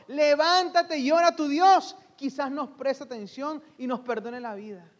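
grito alarmado, más agudo y fuerte; grito del capitán. Acted. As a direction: gritarle al dormido que despierte. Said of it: "le gritó". (el capitán despierta a Jonás a gritos, agudo y fuerte)

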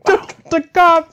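high hammed-up yell of the slogan; mock shout. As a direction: shout it back as a joke.

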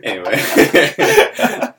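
drawn-out, laughing anyway. Real, not acted. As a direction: stretch it out through a giggle. Laughing, moving on.

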